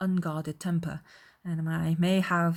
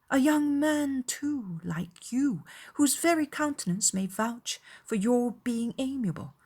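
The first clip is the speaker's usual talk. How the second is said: put-on higher, earnest young lady's voice; earnest, pitched-up championing of him; admiring Elizabeth; 7 semitones higher than usual. A narrator's reading. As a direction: dwell admiringly on the opening words. Reader voices Elizabeth's unspoken addition.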